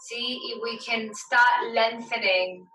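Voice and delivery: measured relaxation-class voice, leaving space to breathe; guided meditation. Acted. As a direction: guide them inward, phrase by phrase, unhurried. Leads yoga meditation.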